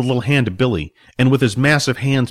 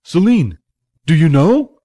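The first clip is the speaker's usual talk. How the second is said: raised, pleading question; urgent appeal; 5 semitones higher, 5 dB louder than usual. A narrator's reading. Billy appeals to Celine for the answer, urgent. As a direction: call out for help with pitch lifted.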